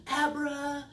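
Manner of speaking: loud, high abracadabra then gasp; excited magic word. Acted. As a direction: cast the spell with a gasp.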